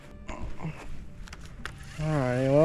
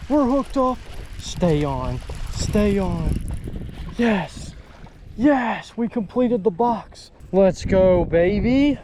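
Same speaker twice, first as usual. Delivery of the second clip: thrilled, raised voice; excited; 5 semitones higher, slower than usual. Real, not acted. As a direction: celebrate landing the fish. Hooking and landing a fish.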